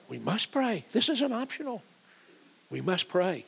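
high-pitched, insistent exhortation; emphatic urging. Real, not acted. Preacher urges the congregation to say it with him, high and insistent. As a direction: pitch leaps up as you press the point home.

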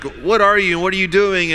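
demanding sailors. Acted. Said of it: "when people say".

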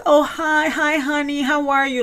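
high, bright mock-gushing greeting; a sister putting on a sweet hello for her brother on speakerphone. [name] greets brother warmly.

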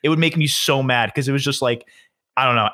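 normal talking voice

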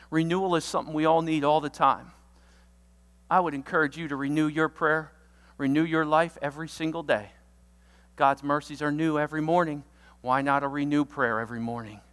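normal talking voice

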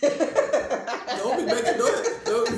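slow, loud words through laughter; real laughter in the room; laughing. Real, not acted. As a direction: crack up while repeating it. Cracking up.

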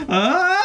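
high wailing scream; overacted death scene. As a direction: ham up a dying wail. Acted sacrifice scene.

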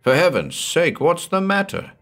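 high, urgent demand. Acted. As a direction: press him for an answer. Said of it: "demanded Jim".

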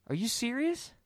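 a hammed-up stunned question; mock disbelief. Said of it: "the woman goes".

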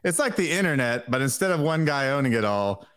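ordinary delivery

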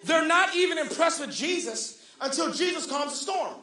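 emphatic preacher drawing out key words; fervent preaching. Real, not acted. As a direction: press the point on the congregation.

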